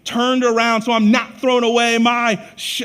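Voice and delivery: loud, high, slowed plea; shouting. Retold or imitated. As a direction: shout it over everyone, begging. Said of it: "He shouted all the more".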